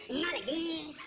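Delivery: mock Mexican accent; exaggerated Mexican accent as a counterexample. Shown as a demonstration. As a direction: show the wrong, Mexican-accented way. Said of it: "Puerto Rican with a Mexican accent".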